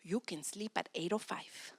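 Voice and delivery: soft, slow whispered grace; a parent granting a later bedtime in a whisper; gentle whisper. Retold or imitated. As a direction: hush it kindly to the child. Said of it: "you're going to say".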